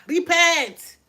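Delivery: sudden loud, high preacher's call; sharp loud exhortation; shouted command. Real, not acted. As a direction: burst out with the order.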